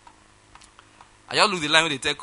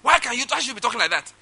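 indignant caller; 8 semitones higher, 4 dB louder, faster than usual. Retold or imitated. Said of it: "being somebody who didn't get my point".

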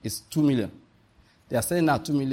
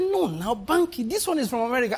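a friend pressing, voice pitched higher; friend insisting; 8 semitones higher than usual. Retold or imitated. Friend urging Banky to invest in American scheme, higher eager insistence. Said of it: "He said".

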